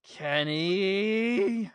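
loud mock yell; yelling a name. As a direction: call out the name. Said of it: "does a camera take and yells".

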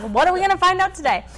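high, loud, fast eager voice; all excited. Retold or imitated. Stake counselor eagerly awaits confession, high excited voice. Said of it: "So all excited".

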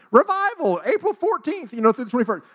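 high, loud mock revival billboard; sign announcement. Church sign advertising a planned revival, loudly announced. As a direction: announce it like a big event.

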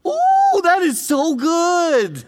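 soaring ooh, then slow, savoring praise; delighted ooh. Real, not acted. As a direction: relish the answer out loud. Delighted reaction to Jesus' answer.

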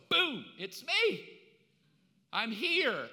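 high, loud and slow, a showy "boom"; a loud, slow, drawn-out ta-da; triumphant reveal. Retold or imitated. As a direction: burst in like a showman unveiling himself. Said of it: "why wouldn't Jesus just go".

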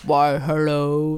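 slow, deep disguised hello; silly slowed down voice. Pretend. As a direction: drag it out low and slow. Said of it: "my attempt at my silly, slowed down voice".

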